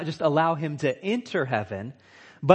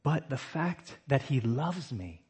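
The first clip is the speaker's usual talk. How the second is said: usual speaking voice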